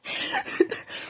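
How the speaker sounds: silent wheezing laugh; breathless giggle. Real, not acted. Reader cracking up mid-sentence, wheezing laugh. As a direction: crack up without voice.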